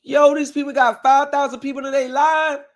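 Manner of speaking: high, loud, outraged yell; disbelieving shout. Retold or imitated. Retelling watching a livestream, shouting high in outrage. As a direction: yell it like you can't believe your ears. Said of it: "I'm like".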